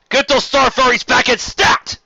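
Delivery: high, loud commander's shout; barked order. Acted. Captain shouting to crew to recall fighters, loud barked order. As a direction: bark the order across the bridge. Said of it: "barked the captain".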